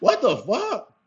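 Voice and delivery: retold high startled yelp. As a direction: jolt up in shock at the ball. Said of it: "I'm like".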